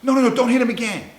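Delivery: high, loud panicked pleading; frantic no. Retold or imitated. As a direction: beg him to stop at full shout. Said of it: "These two assholes had the balls to say".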